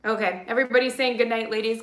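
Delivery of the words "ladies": ordinary talk, nothing marked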